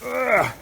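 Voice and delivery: short high grunt of effort. Real, not acted. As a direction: ugh of strain. Effort while digging through snow.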